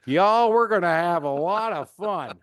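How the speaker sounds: hammed-up Southern coach voice; fake Southern accent. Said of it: "I feel like, uh, Brian Kelly going to L S U".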